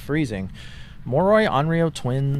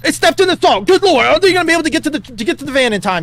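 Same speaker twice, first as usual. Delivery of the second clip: loud, rising hype commentary; excited play-by-play; 11 semitones higher, 7 dB louder, faster than usual. Acted. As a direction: call the finish like a sportscaster. Live play-by-play at the finish.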